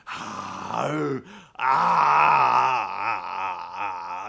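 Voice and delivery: low wordless grunting, one shouted; Ultimate Warrior grunts. Retold or imitated. Hosts recalling a wrestler haunting a mirror, gruff grunts. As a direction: growl like a raving wrestler. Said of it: "like, not saying anything".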